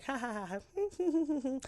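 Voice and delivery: high, drawn-out imitation cackle; mocking laugh. [name] laughing at Gary as she walks out, mock laugh. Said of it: "basically laughing at Gary".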